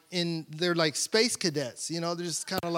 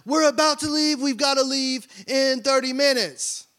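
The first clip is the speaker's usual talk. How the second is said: wife calling out; 6 semitones higher, 3 dB louder than usual. Retold or imitated. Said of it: "she's like".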